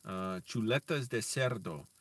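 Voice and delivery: ordinary talk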